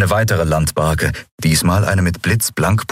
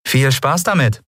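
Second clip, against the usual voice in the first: higher, lightly knowing send-off; knowing smile; 8 semitones higher than usual. A narrator's reading. Courier handing over a letter, lightly knowing. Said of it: "sagte sie mit wissendem Lächeln".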